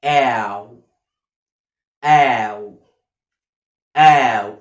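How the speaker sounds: "آو" as a low, long, open ow; "ow" sound model. Shown as a demonstration. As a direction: open the mouth wide and stretch "آو", loud and low. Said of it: "اسمع الصوت ده كويس جدًا جدًا". (صوت "آو" مفتوح وممدود)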